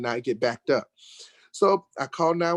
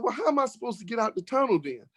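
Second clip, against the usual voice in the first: fast, much higher, exasperated; indignant retort; 8 semitones higher, faster than usual. Retold or imitated. Telling the police the tow makes no sense, fast high indignant. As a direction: pile on the outrage quickly.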